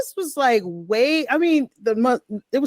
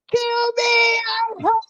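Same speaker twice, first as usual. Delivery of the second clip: much higher joking squeal; mock squeal; 13 semitones higher, slower than usual. Host plays the doomed victim answering the doctor, higher joking squeal.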